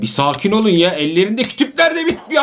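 normal talking voice

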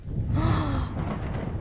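startled gasp of realisation; a sudden gasp at a discovery; sharp gasp. Acted. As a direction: catch your breath in surprise. Kids fear being discovered.

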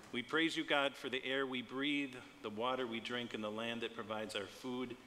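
ordinary talk, nothing marked

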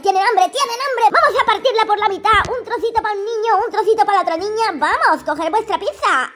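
ordinary talk, nothing marked

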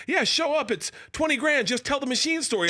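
mock corporate client offer; breezy booker. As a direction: toss it off like a booker. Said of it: "they're like".

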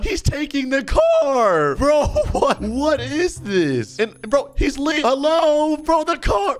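high, drawn-out wail of disbelief; hammed-up dismay. Son watching the car get taken, hammed-up dismay. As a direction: cry out high in mock horror.

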